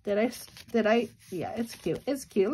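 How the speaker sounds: ordinary delivery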